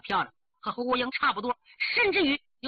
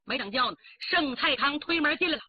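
usual speaking voice

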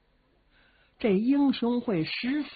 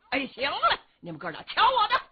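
high, fast, swaggering yell; cocky shout; 9 semitones higher, 4 dB louder than usual. Acted. Xiong Kuohai telling his brothers to watch him, a cocky high shout. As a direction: brush off the warning and show off. Xiong boasting before the charge.

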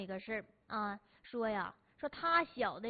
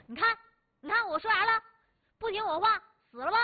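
high, loud told-you-so bark; gloating table-banging shout; 9 semitones higher, 7 dB louder than usual. Retold or imitated. Grandpa slamming the table over his dead friend, loud gloating. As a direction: slam the table and crow that you were right. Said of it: "就拍桌子说呀".

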